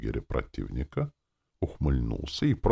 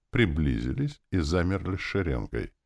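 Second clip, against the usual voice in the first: ordinary delivery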